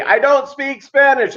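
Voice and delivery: high, loud exasperated shout; mock yell. Cashier yelling at the narrator, loud and exasperated. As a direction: bark it at the customer. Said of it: "as he's yelling at me".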